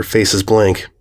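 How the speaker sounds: plain reading voice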